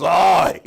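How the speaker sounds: the congregation's big pained oi; loud groaning oi. Retold or imitated. Congregation groaning at the rabbi's appeal with a loud oi. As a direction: groan like a crowd asked for money. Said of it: "the whole shul goes".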